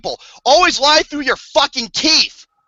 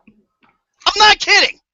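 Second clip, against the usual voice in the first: insistent disbelief; 9 semitones higher, 4 dB louder, much slower than usual. Real, not acted. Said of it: "insisting on absurd plot".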